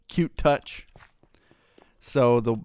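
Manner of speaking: ordinary delivery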